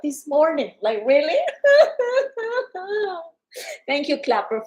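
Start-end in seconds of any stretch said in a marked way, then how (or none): 1.4-3.2 high teary laugh. Real, not acted. Seller laughing about her TikTok ban, high and teary. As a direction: laugh close to crying, high.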